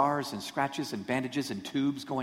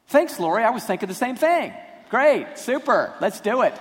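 sarcastic; 8 semitones higher, 7 dB louder than usual. Retold or imitated. Resentful reply to Lori's request.

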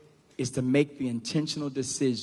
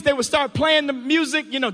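ordinary talk, nothing marked

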